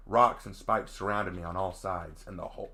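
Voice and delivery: ordinary delivery, nothing marked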